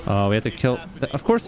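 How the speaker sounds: ordinary talk, nothing marked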